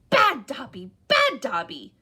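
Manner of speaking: loud self-punishing elf shouts; shouting. A narrator's reading. Elf punishing himself, shouting loudly. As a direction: yell it while banging his head.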